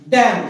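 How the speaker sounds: डैम ends on m with no n sound; silent n. Shown as a demonstration. Teacher showing students डैम said without the n. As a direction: say डैम closing on the m. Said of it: "बोलते हैं डैम".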